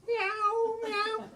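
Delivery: high, plaintive imitation meow; high-pitched mock cat call. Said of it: "But it's going".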